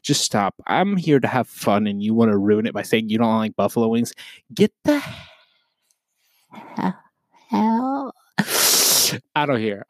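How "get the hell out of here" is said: high stretched get the hell; mock eviction. Real, not acted. Podcaster kicking out wing haters, hammed-up high yell. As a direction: fling them out in playful outrage.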